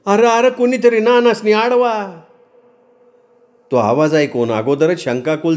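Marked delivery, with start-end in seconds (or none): 0.0-2.2 high, urgent woman's call; alarmed high-pitched yell; loud cry for help. Acted. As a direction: cry out for someone to stop him. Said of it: "सखू मोठ्यानं ओरडली".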